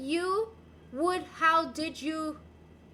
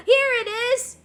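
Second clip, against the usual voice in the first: loud, higher triumphant reveal; excited find; 7 semitones higher, 10 dB louder than usual. Real, not acted. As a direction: show off the find. Finding the baguette.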